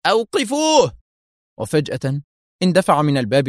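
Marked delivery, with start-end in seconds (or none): 0.1-1.0 very high-pitched command; urgent cry. A narrator's reading. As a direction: call out the order urgently. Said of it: "وهو يهتف". (هتاف آمر)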